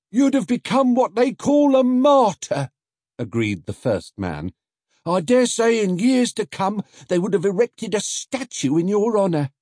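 high, loud put-on voice of a market man; first man. First man teasing Paddington, high and loud. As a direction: build it up grandly then undercut.

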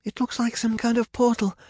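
high-pitched cry of alarm. A narrator's reading. Rapunzel spots a portal ahead, alarmed. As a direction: call it out urgently.

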